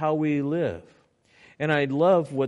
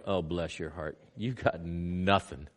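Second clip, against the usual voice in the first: much softer, lower, slow inner aside; 8 semitones lower, 9 dB quieter than usual. Retold or imitated. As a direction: mutter it to yourself, patiently amused. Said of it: "in your heart, you're going".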